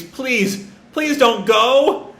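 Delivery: high, drawn-out begging; acted plea, stretched at the end; tearful pleading. Boy begging Luffy not to leave, tearful pleading. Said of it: "he just continues".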